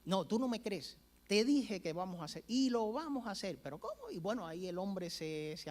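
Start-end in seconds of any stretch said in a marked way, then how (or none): 0.0-3.6 insistent. Retold or imitated. Fidel insisting he will build it. 3.6-4.1 shrill, very high squeak of disbelief; mock astonished how; stunned disbelief. Man stunned by Fidel's promise.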